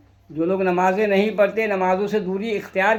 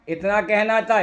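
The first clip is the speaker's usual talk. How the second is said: usual speaking voice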